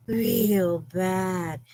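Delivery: Jennifer Coolidge voice. Retold or imitated. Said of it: "in my best Jennifer Coolidge voice".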